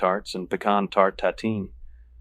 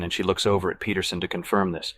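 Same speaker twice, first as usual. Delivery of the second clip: usual speaking voice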